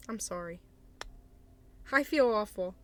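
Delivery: usual speaking voice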